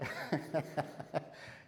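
quiet airy chuckle at himself; soft breathy laugh. Real, not acted. Pastor laughing softly at himself during the sermon. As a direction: laugh softly, caught out by his own excitement. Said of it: "getting a bit excited here".

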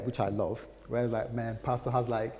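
ordinary delivery

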